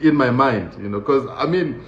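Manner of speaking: usual speaking voice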